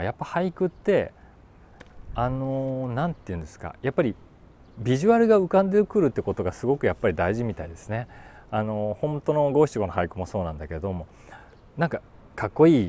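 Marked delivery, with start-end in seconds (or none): none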